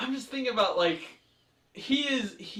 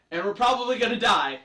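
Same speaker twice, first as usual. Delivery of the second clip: loud, raised comic punchline; funny delivery; 6 semitones higher, 10 dB louder than usual. Retold or imitated. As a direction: imitate his comic announcement of doom. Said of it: "so funny how he delivers it".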